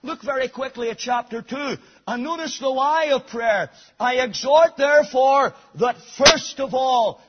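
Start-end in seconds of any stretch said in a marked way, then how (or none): 4.0-7.2 louder, ringing pulpit read; emphatic scripture reading. Acted. Preacher reading Paul's charge to the congregation, loud. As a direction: proclaim each word.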